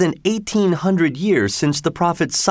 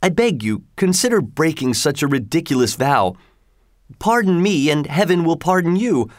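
higher, begging fisherman; a fisherman begging for his life; pleading fisherman; 6 semitones higher than usual. A narrator's reading. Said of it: "cried the fisherman".

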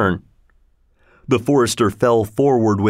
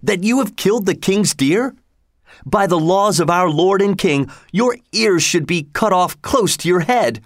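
higher, lightly voiced stern forester; stern threat; 7 semitones higher than usual. A narrator's reading. Forester warns Robin of the king's law, higher.

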